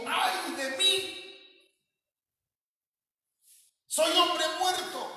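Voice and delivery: high, slow lament of a doomed man; anguished prophet's cry. Retold or imitated. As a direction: cry it out like Isaiah in despair. (lamento agudo y lento de profeta angustiado)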